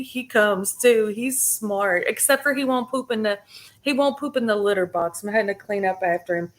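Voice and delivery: ordinary talk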